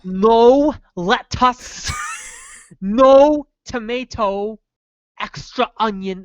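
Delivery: very slow, drawn-out mock Davy Jones accent. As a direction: order word by word as a hammed-up Davy Jones. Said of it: "It was like Davy Jones' accent".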